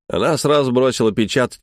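usual speaking voice

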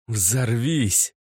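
ordinary delivery

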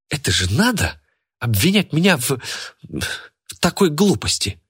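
high-pitched, affronted complaint; indignant fury. A narrator's reading. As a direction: splutter with outrage without shouting. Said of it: "Её всю трясло от ярости". (возмущение на повышенных тонах)

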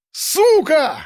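high-pitched furious curse; enraged yell. A narrator's reading. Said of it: "заорал он".

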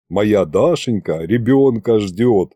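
usual speaking voice

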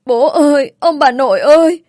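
put-on high, loud wail of a child calling for family; narrated sobbing child, voiced high and loud; tearful child's cry. A narrator's reading. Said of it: "khóc tức tưởi nói".

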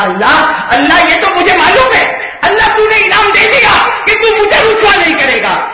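rising to a high, loud cry; preaching at full force. Acted. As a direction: build each line to a fervent peak. (بلند ہوتی پرجوش خطابت)

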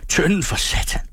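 high-pitched exclamation of dismay; alarmed curse. A narrator's reading. Man reporting the leaking tar barrel, alarmed high cry. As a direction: curse out the bad news, pitch jumping.

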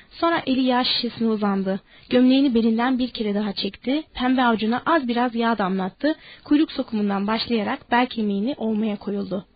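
plain reading voice

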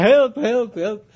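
high, loud help calls through laughter; hammed-up yell of the drowning man; laughing mock cry for help. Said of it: "die andere die schreeuwen".

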